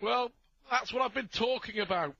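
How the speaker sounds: high, loud, delighted; excited commentary. Real, not acted. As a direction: praise the finish with real delight. Commentator thrilled after finish.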